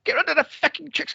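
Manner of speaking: normal talking voice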